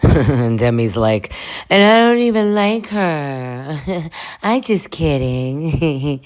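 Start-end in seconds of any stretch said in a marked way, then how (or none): 1.7-6.2 high, drawn-out whine, then slow giggly retraction; hammed-up tipsy drawl; mock drunk whine. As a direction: imitate a tipsy girl whining then kidding. Imitates drunk Demi joking.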